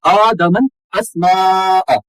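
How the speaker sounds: आदम अल अस्माअ slowly, last long vowel held; slow chant, prolonged अस्माअ; long madd. Shown as a demonstration. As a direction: stretch the aa of अस्माअ for several beats. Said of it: "पांच अलिफ के बराबर खींच".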